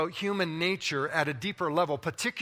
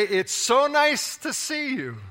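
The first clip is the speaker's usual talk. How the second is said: mock polite greeting; 8 semitones higher, slower than usual. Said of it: "It's all about language, tone, and intonation".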